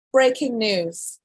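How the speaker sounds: slow announcer-style headline; dramatic headline read. Shown as a demonstration. Vlogger announcing headline to viewers, slow and dramatic. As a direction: draw out the headline like a news anchor. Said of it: "Wait, wait, wait, wait".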